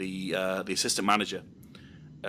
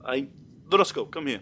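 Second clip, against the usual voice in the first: calling out; 6 semitones higher, 8 dB louder than usual. Retold or imitated. Manager calling the entertainer over, loudly. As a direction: boss calling him over, loud and high. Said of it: "he was like".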